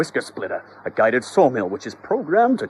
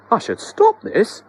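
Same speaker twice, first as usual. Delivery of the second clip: much higher, slow worried voice; hesitant resolve; high anxious husband; 11 semitones higher, 4 dB louder than usual. Acted. Husband decides to intervene, high and worried.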